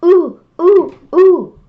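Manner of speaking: put-on high, loud "ooh, ooh" of a child desperate to answer; eager kid. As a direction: imitate a pupil bursting to be picked. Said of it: "put your hand up and say".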